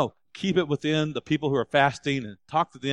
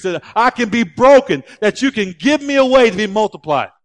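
rising, louder fervent prayer; 4 semitones higher, 6 dB louder than usual. Acted. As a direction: pray it with growing conviction. Models a fasting prayer.

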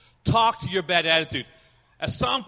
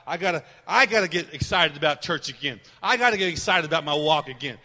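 ordinary talk

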